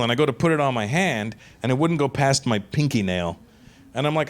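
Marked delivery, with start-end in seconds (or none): none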